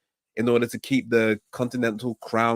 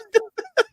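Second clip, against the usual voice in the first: high, squealing laugh; burst of laughter; 3 dB louder than usual. Real, not acted. Cracking up at co-host's joke, high squealing laugh. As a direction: crack up at the remark.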